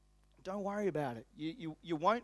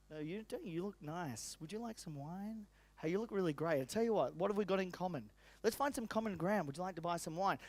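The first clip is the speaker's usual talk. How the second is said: softer, friendly wine seller, lightly voiced; friendly salesman; 3 semitones lower, 8 dB quieter than usual. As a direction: coax the customer gently and softly. Friendly wine seller building rapport.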